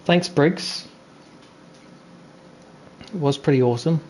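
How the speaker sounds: usual speaking voice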